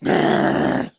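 low, loud rolling growl; gargoyle growl. A narrator's reading. Gargoyle threatens you from the shore, growling. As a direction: growl menacingly like a stone beast. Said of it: "The gargoyle growls at you".

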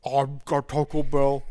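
mock talking through food; words muffled by a mouthful; mouth full. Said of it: "talking while he's got his mouth full".

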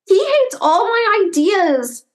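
high, slow, whiny complaint; complaining. Acted. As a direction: grumble about your brother. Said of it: "Jonathan complained".

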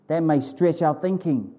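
usual speaking voice

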